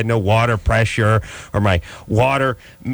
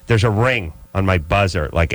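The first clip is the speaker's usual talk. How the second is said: ordinary talk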